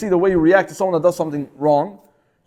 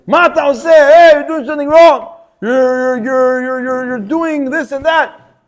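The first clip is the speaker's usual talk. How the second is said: loud, higher scolding yell; yelling at someone; 6 semitones higher, 5 dB louder, slower than usual. Retold or imitated. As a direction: scream at him for doing wrong. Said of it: "I scream at the person".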